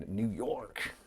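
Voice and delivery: attempted hillbilly American New York; hillbilly accent. Shown as a demonstration. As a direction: have a go at a hillbilly twang. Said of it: "needs a bit more hillbilly".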